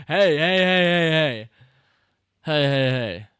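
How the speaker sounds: high, rapid-fire shouted heys; loud calling out. Real, not acted. Streamer shouting hey at the film, loud calling out.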